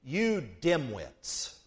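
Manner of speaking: very slow, dropped low and soft; Jesus quietly scolding the disciples, drawn out; blunt rebuke. Acted. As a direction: lay the insult down slowly and quietly. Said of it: "Jesus says to them".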